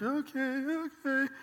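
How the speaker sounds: high, small, wavering okay; timid high-pitched kid voice; reluctant child. Acted. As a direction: agree like a nervous kid. Scared child reluctantly agreeing.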